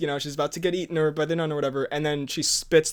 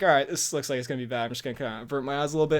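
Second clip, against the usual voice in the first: quick nervous mutter; 3 semitones lower, 4 dB quieter, faster than usual. Retold or imitated. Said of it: "And then I was like".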